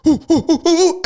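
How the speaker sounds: high, loud panicked owl hooting; panicked owl hoots. Retold or imitated. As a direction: hoot like a startled owl to warn someone. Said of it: "I sounded like a fucking panicked owl".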